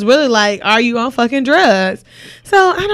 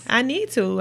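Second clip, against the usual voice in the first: usual speaking voice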